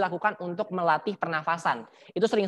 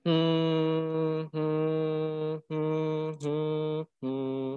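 gumaman suara dada; 2 semitones lower, 4 dB quieter, much slower than usual. Shown as a demonstration. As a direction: hum from the chest, holding one note. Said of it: "Teman-teman sekarang kayak gini". (gumaman panjang dan datar dari dada)

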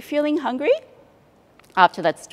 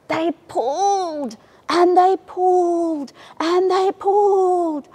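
high, slow, straining heave; slow effortful pulling chant; 7 semitones higher, 4 dB louder, slower than usual. A narrator's reading. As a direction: heave each word like pulling hard.

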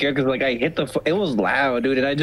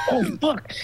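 startled curse. Retold or imitated. Panicked realisation after hitting car.

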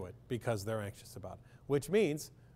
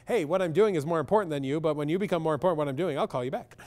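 louder, brisk, bluntly dismissive phone answer; blunt honest brush-off; 3 semitones higher, 7 dB louder, faster than usual. Shown as a demonstration. Therapist acting out a blunt phone reply to a caller, louder and brisk. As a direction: say it like you really mean it's more important. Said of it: "That doesn't sound so nice".

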